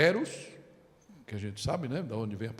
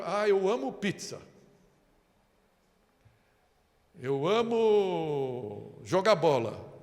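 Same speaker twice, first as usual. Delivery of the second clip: mock casual love; 4 semitones higher, 4 dB louder, much slower than usual. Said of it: "a gente pode".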